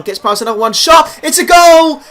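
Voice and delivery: loud, high shout bursting on the goal; excited goal call. Acted. Commentator hails the club's first league goal, excited shout. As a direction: commentator erupting as the ball goes in.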